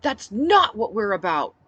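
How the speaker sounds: loud, slow bellow. Acted. She bellows at the crowd to stop the killing talk. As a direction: drive each word home. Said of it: "I bellowed".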